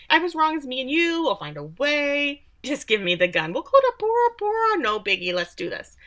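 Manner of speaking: high-pitched imitation that swoops back down; hammed-up pleading, sliding out of the squeal; mock bargaining. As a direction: bargain in a silly high voice, then drop it. [name]'s mocked pleading continues.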